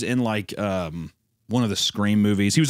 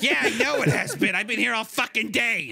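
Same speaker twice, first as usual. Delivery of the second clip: higher laughing mock wife; 9 semitones higher than usual. Mocking drunk wife snapping at husband, higher and laughing. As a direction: pitch it up, resentful, half laughing. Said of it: "And she's like".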